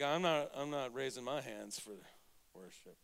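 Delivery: low, soft mock grumble; reluctant churchgoer. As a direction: mutter like someone too proud to raise hands. Imitating reserved churchgoers.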